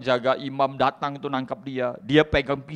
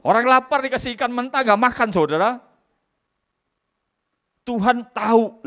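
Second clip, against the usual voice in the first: preaching at full force; 12 semitones higher, 8 dB louder than usual. Acted. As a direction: stir the congregation. Preaching climax. (khotbah keras dan bersemangat)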